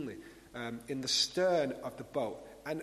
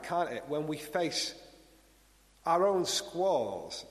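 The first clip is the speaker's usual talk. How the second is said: usual speaking voice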